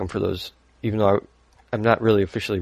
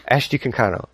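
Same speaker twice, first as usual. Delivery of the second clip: "Ashti Kankano" in one quick run, falling at the end; said fast; 2 semitones higher than usual. Shown as a demonstration. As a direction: rattle the name off quickly. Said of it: "say the name as fast as you can".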